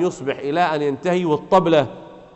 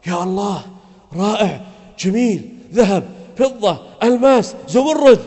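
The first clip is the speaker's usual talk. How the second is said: mock amazement; 4 semitones higher than usual. Imagined amazed reaction to treasures.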